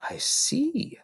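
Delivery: breathy hushed reply; quietly taking it in; whispered I see. Acted. Mrs Phelps quietly absorbing the news, whisper.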